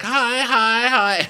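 very high mock greeting; put-on high-pitched greeting; gushing greeter. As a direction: sing out imitated hellos, way up high. Mimicking a guest greeting everyone.

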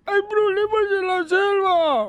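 high, strained lost-voice call; voiceless tiger. Acted. Tiger warning Tarzan, strained high lost voice. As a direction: speak as if the voice is gone. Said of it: "Era fónico".

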